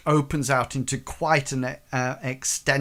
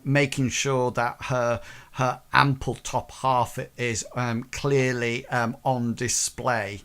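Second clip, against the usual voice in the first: usual speaking voice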